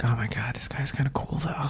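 whispered secret admiration; mock whisper. Cohost whispers about liking the villain, mock hush. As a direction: breathe it like a guilty secret. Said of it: "but you're like".

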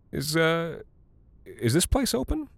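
hesitant question. A narrator's reading. Nervous question to strangers.

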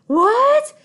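high, loud "what" of disbelief; shocked disbelief. Retold or imitated. Teen girlfriend hears boyfriend deny dating her, shocked outburst. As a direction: blurt it out, pitch shooting up in shock.

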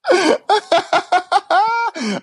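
shrieking high-pitched laugh. Real, not acted. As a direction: burst into squealing laughter. Reacting to partner's joke.

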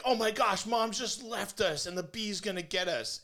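high, loud mock panic of a kid; high loud panicked daughter. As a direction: squeal it like a scared girl. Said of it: "Kate was like".

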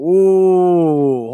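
loud startled whoa at the big order. Retold or imitated. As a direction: stop her in her tracks. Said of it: "I was like".

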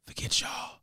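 hushed defiant whisper. Pretend. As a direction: drop to a secret whisper.

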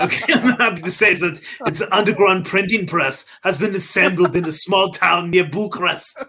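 hammed-up German accent, tickled by it; exaggerated Germanic Kozlov voice; German-sounding Russian accent. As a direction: lean into a botched Russian that comes out German. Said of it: "this is German as fuck".

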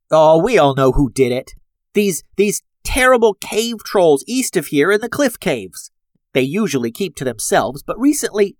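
nasal voice with a pinched nose; pinching nose. A narrator's reading. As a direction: talk with the nose clipped shut. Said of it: "had a clothespin on her nose".

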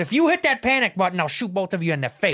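high, urgent menace; robber's threat. Acted. Robber warning two hostages, acted menace.

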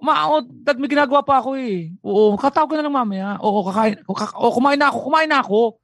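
lightly voiced kid answering a parent; mild mock child saying yes, yes to dad; dutiful child on phone. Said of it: "pagkakamag-anak".

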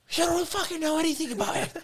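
high, loud mock yell of an enraged old man; furious grandfather. Grandfather berates his grandson for quitting, high furious yell. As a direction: scream at him like a livid grandpa.

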